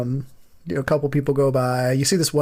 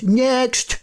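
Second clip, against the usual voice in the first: calling next; 8 semitones higher than usual. Acted. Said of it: "and he goes".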